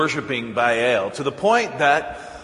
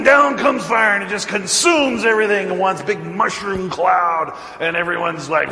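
normal talking voice

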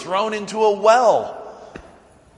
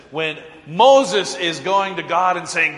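normal talking voice

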